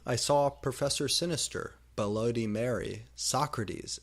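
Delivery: usual speaking voice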